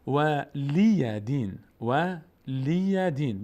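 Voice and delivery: و لی دین as "wa-LIII-ya diin", each syllable open; correct reading. Shown as a demonstration. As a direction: slowly stretch لی, single d in دین. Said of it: "اصلش اینه".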